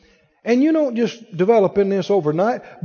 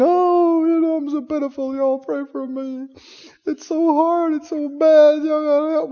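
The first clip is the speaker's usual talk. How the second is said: higher, whiny pitiful-me voice; mock self-pity; 8 semitones higher than usual. Preacher mocks a self-pitying believer, higher whiny plea. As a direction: play the pitiful victim begging for prayer. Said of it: "you just roll over and".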